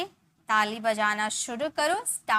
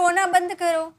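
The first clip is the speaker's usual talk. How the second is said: mild scolding; 6 semitones higher, 5 dB louder than usual. Retold or imitated. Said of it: "हम बोलते हैं ना".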